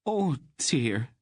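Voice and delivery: worried plump queen pleading; fretful little queen. A narrator's reading. Queen begging Aunt Paul to keep the king safe, fretful.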